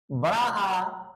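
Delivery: आ held long and open; long vowel demo. Shown as a demonstration. Teacher to students, holding the long आ. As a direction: stretch the आ sound. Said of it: "आ, आ ऐसा बोला जाता है".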